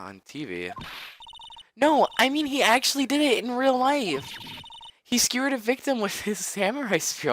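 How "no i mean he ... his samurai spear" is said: put-on high frantic girl. [name] tells Nick the Samurai was arrested, high panic.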